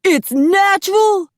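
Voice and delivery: high, loud shocked squeal; astonished. A narrator's reading. Apollo learns the hair is undyed, high astonished cry. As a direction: recoil in theatrical disbelief. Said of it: "Apollo jumped back".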